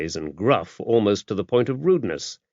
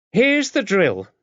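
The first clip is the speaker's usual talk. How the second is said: high, louder, barked start of orders; brisk drill opener; 12 semitones higher, 4 dB louder, slower than usual. A narrator's reading. Dame Tabitha briefing the new guest, brisk command. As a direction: announce it like a sergeant.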